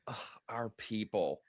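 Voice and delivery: whispered in disgust. Retold or imitated. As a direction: breathe it out with scorn. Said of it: "have the audacity to say".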